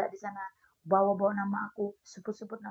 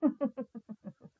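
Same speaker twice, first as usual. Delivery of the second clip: short villain cackle; evil laugh; 5 semitones higher than usual. Real, not acted. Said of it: "Lama aku udah nggak ketawa jahat". (tawa jahat)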